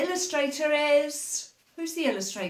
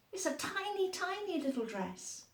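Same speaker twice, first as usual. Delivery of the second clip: tiny mouse voice; 7 semitones higher, 10 dB quieter than usual. A narrator's reading. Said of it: "said the little mouse".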